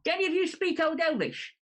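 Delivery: high-pitched plea to companions; in-character appeal. Acted. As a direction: ask the party as the rattled character.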